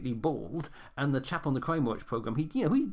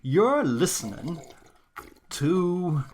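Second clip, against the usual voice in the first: radio announcer; 2 semitones lower, 6 dB louder, much slower than usual. Acted. Radio show opening.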